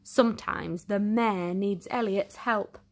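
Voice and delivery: usual speaking voice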